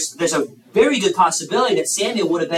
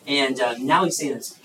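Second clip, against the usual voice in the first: normal talking voice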